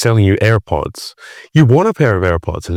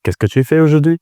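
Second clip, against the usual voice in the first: higher, French-accented demo line; 7 semitones higher, faster than usual. Shown as a demonstration. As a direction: show off your French accent. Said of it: "Can, can we get some?".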